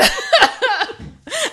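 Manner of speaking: high, loud burst of laughter; shocked shrieking laugh. Real, not acted. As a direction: squeal with laughter.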